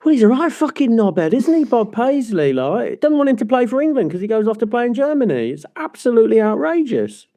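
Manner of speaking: high-pitched, put-on indignant rant; mock outrage. Mock rant against Bob Paisley.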